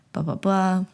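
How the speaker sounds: ordinary talk, nothing marked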